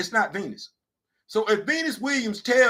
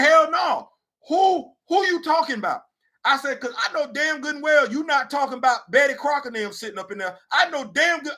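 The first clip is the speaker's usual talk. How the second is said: retold angry outburst; 7 semitones higher than usual. Comic retelling of ranting at the HR guy. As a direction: rant at HR, offended. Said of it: "I got mad".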